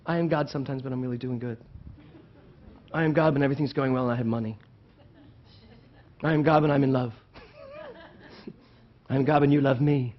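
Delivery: small, hurried conditional affirmation; mock hedging. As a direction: toss it off fast, undercutting the claim. Mocks conditional, hedged versions of the affirmation.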